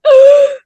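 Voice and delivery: sudden high loud gasp of shock; sharp gasp. Retold or imitated. Retelling the shock, a sharp gasp. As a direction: gasp as the news lands. Hearing mom must leave.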